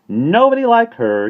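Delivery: high, loud bird chirp; cheery starling pipe; chirpy. A narrator's reading. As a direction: sing her praises brightly. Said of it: "he remarked chirpily".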